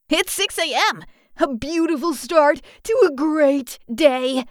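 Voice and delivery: high, gleeful announcement; eager early-bird brightness; chipper morning enthusiasm. A narrator's reading. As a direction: greet the dawn with cheer. Chipper Alex answers groggy cats.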